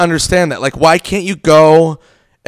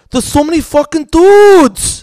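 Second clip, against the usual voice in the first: high, loud, drawn-out mock yell; tantrum; 12 semitones higher, 6 dB louder, slower than usual. Buddy complaining about San Francisco, hammed-up tantrum. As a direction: imitate a friend whining into a shout. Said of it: "he fucking threw a tantrum".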